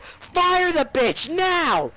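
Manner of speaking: high, very drawn-out yell; hammed-up screamed insistence; mock furious demand. Student repeating demand to the principal, mock furious shouting.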